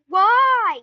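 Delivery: one long, very loud "why"; retold demanding shout. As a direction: yell it like an exasperated mom. Mother demanding an explanation, retold.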